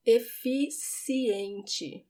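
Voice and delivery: Eficiente very slow, C as a clear s; Eficiente, efissiente, slowly; careful pronunciation. Shown as a demonstration. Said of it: "pay attention to the C".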